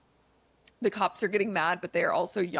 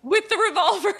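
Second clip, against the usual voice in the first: laughing; 13 semitones higher, 8 dB louder than usual. Real, not acted. Finishing the Clue joke.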